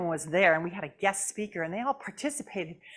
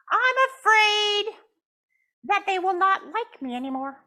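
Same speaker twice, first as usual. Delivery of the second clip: pouting puppet; 11 semitones higher, 7 dB louder than usual. Acted. Puppet Jasmine tells the counselor she is mad, pouting high. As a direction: stretch out the sulk.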